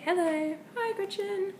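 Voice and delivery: high, sing-song hello to the dog; high cooing greeting. Shown as a demonstration. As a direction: coo it up high like greeting a beloved pet. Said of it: "so I just say".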